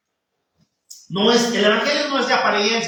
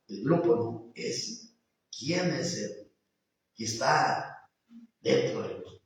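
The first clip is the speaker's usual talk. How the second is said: hushed preaching; 6 semitones lower, 9 dB quieter than usual. Real, not acted. Preacher murmuring to the congregation, hushed and intimate.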